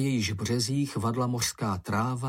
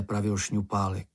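usual speaking voice

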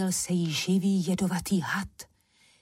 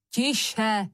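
mild raised-voice order; shouted command; 3 semitones higher than usual. A narrator's reading. Mikeš silencing the quarrelling sisters, mild shout. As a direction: call for silence, sharply but contained. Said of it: "rozkřikl se Mikeš".

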